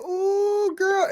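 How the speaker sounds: high-pitched drawn-out coo; gossipy reaction. Retold or imitated. Fan reacting to a wrestler's insult, gossipy thrill. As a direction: coo it high, relishing the drama.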